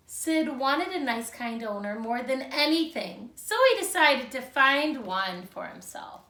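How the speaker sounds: put-on lively read-aloud for young children; storybook reading. A narrator's reading. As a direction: read it like a picture book to second graders. Teacher reads picture book aloud.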